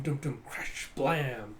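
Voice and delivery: ordinary delivery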